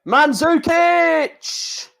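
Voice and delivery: mock announcer hailing the player; drawn-out name call. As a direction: sing the name out like a stadium announcer. Calling out drawn player names.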